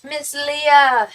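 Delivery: high, loud, bright greeting; excited greeting. Real, not acted. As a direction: call her name out happily. Greeting the viewer again.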